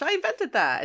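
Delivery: high-pitched mock-proud announcement; excited boast. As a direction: declare it high and delighted, falling at the end. Wheeljack proudly claims the invention.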